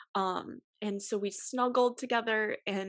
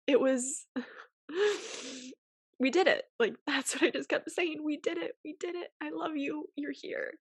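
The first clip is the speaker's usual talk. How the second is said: tearful; 8 semitones higher than usual. Real, not acted. Reliving first moments with newborn.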